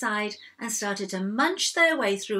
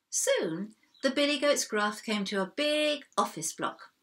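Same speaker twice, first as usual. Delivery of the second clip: ordinary delivery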